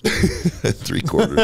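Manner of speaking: slow, drawn out mocking echo; teasing repeat. Host teasing his co-host about claiming three quarters, slow mock echo. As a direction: savour it slowly to tease him.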